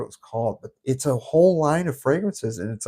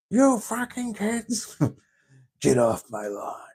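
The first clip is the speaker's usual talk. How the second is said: put-on high, slow cranky old coot; old prospector; 9 semitones higher, slower than usual. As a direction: shake your fist at the kids. Grouchy old man yelling at kids.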